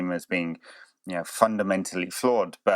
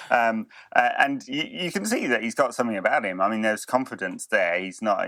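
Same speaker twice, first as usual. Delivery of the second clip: ordinary talk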